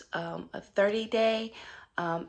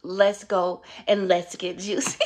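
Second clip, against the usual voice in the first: ordinary talk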